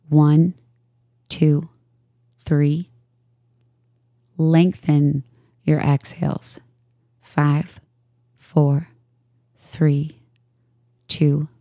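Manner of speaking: calm, even voice with long pauses between phrases; unhurried yoga nidra guidance; guided meditation. Real, not acted. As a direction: guide listeners into stillness, leaving space after each phrase.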